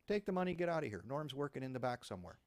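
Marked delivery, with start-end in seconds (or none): 0.1-1.0 higher, louder mock clerk, hands up; mock bakery clerk. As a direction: play the scared clerk giving in. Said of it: "And it's like".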